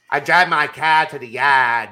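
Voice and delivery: mock Southie accent; Boston accent. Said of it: "My, my Boston".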